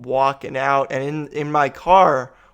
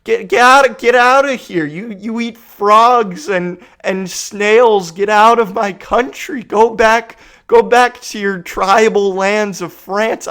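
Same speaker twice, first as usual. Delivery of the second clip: loud, high mock-furious rant; higher, louder exasperated tirade; frustrated inner rant; 7 semitones higher, 7 dB louder than usual. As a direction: rant at them like you're losing it. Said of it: "so frustrated. I'm like".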